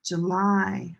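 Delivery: "July" as juh-LIE, end drawn out; second-syllable stress. Shown as a demonstration. Teacher contrasting it with Julie, "July" as juh-LIE. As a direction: lean on the last syllable of "July". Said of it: "Very different from Julie".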